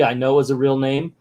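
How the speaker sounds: ordinary talk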